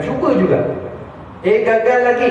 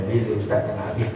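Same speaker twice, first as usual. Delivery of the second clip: muttered aside; 7 semitones lower, 7 dB quieter than usual. Acted. Voicing a restless listener's thought.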